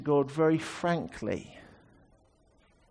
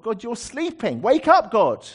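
loud, high mock rebuke of a sleeping God; rebuking God loudly; 8 semitones higher, 7 dB louder than usual. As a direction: shout him awake with bold frankness. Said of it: "saying the same thing to God".

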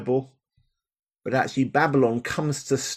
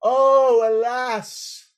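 higher, wailing Oh then sighing alas; preacher demoing the lament side; 12 semitones higher, 4 dB louder, much slower than usual. Shown as a demonstration. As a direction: give us the grief of it. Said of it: "to feel both, on the one hand".

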